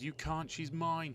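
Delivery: mild roar. A narrator's reading. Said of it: "he roared".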